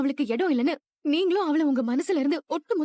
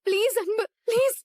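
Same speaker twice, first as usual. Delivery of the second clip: desperate begging; 5 semitones higher than usual. Acted. Woman begs husband to accept her.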